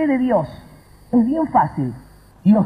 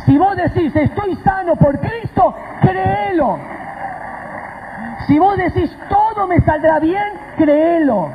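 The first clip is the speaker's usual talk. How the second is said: high, impassioned preaching; fervent exhortation; 6 semitones higher than usual. Real, not acted. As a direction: urge the congregation to believe. Exhorting the congregation to believe.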